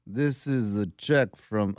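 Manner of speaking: slow, drawn-out imitation of wife. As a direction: mimic his wife, slowly. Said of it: "Your wife talks like that?".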